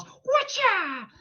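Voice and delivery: high, sharp fighting shout; kung fu yell. Acted. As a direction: yell like a martial artist. Ear-fighting move, martial yell.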